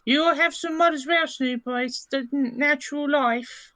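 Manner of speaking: high-pitched, lilting character voice; Blossom in character. Acted. Blossom offers mud to Snooper, high character voice. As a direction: push the voice right up high, drawing out the end. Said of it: "She's like".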